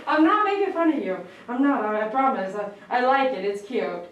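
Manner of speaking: normal talking voice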